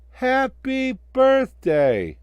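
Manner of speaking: very high, loud, drawn-out cheer. Acted. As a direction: shout it to the crowd. Birthday cheer.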